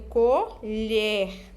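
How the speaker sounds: colher slowly, "co" then a held "lher"; colher sounded out for learners; syllable by syllable. Shown as a demonstration. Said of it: "trabalhando aqui as sílabas".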